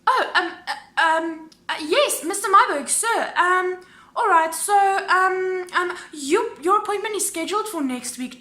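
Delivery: high, loud nervous stammering; imitated flustered agent. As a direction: stumble through ums in a high put-on voice. Agent flustered by stern husband.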